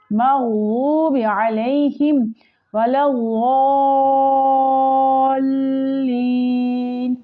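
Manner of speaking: slow melodic tajweed chant; Quranic recitation. Shown as a demonstration. Teacher demonstrating the recitation to students, slow melodic chant. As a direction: draw each word out in a measured chant.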